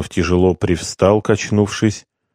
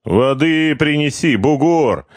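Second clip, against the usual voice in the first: high, lordly command to a slave; a hint of a capricious patrician ordering a slave; 8 semitones higher, slower than usual. A narrator's reading. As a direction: order water like a spoiled master. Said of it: "тоном капризного патриции".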